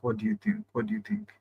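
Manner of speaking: high, soft teasing question; high playful singsong. Shown as a demonstration. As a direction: tease them softly up high. Teasing the viewers for answers.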